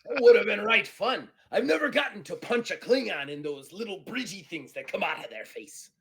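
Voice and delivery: loud, high, rollicking pirate voice; boisterous pirate. Acted. Pirate disappointed to miss punching a Klingon, boisterous. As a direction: crow it out like a rowdy pirate.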